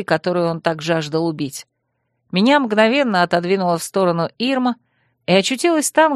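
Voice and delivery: ordinary delivery, nothing marked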